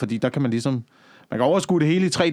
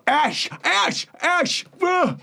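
mock shouting, much higher; imitating a shout; 14 semitones higher, much slower than usual. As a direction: call out the alarm from the boat. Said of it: "der bare råber". (efterlignet råb, meget højere)

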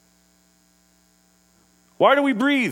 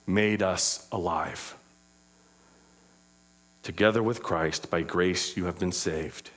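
low, soft, reverent Bible reading; hushed reading of Ephesians; scripture reading; 14 semitones lower, 5 dB quieter than usual. Acted. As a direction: read the verses gently.

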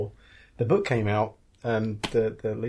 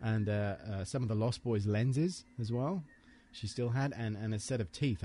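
ordinary talk, nothing marked

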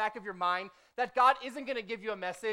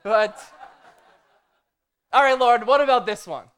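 brushing it off; 11 dB louder than usual. Retold or imitated. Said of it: "And I'm like".

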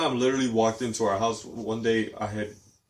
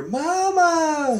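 much higher and louder, calling up the stairs; mock call; 6 dB louder than usual. Grandma calling upstairs on arrival, mock loud call. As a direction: call out like grandma announcing herself. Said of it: "and I hear".

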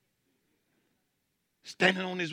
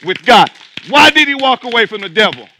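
loud, higher preaching; shouting preacher building the point; preaching at full force; 6 semitones higher, 15 dB louder than usual. Acted. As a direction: drive it home to the church.

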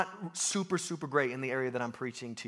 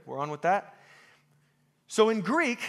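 normal talking voice

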